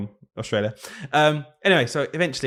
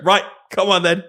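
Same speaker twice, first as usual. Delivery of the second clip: exasperated mum; 7 semitones higher, 6 dB louder than usual. Retold or imitated. Mum gives up, orders him out.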